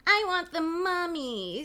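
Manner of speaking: high, drawn-out whine; loud, slow, babyish pleading; whining little sister. Acted. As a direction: whine it like a pestering small child. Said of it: "Susie whines again".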